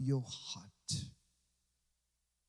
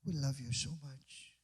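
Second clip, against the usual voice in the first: soft prayer; 2 semitones lower, 6 dB quieter than usual. Retold or imitated. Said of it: "Every time we come".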